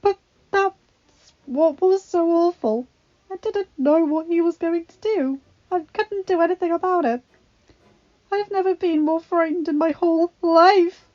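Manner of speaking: high, loud frightened crying; distraught. A narrator's reading. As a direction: sob out the fear. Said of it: "she cried".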